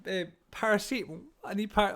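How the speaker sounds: high, feeble mock moan for painkillers; acting out own headache moaning; moaning imitation. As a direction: play up a pitiful, needy whinge. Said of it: "I'll just moan about it".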